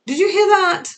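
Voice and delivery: asking mom, startled by her own stutter, loud and high; startled question. Retold or imitated.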